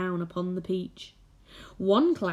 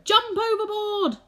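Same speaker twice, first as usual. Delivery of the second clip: panicked shout; 4 dB louder than usual. Acted.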